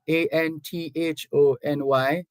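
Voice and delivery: ordinary delivery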